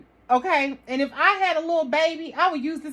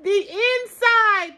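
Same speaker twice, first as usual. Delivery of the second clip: higher and louder, amazed repeat; excited; 8 semitones higher, 5 dB louder, slower than usual. Real, not acted. To her viewers, she repeats it in higher, louder delight. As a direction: squeal it out in delight.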